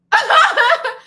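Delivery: big high burst of laughter; loud laugh. Real, not acted. Cracking up at her own neighbor story, a loud laugh.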